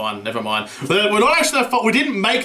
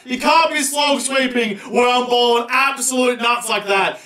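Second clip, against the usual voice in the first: ordinary talk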